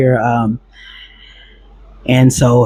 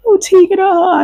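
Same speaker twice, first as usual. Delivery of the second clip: much higher, wailing imitation of fake tears; mock fake crying. Girlfriend fake-crying his name to the cops, mock wail. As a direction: ham up her crocodile tears. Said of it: "out here crying".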